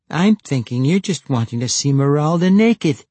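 high, loud teasing voice; teasing girl. A narrator's reading. Girl accusing Jacka of wanting to see Meralda, teasing.

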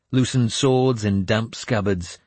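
ordinary delivery

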